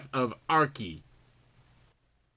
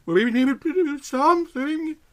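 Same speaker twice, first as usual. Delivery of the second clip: much higher, urgent character voice; quoted line; 13 semitones higher than usual. Acted. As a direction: say it as the worried friend. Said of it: "Yvonne's line, spoken high".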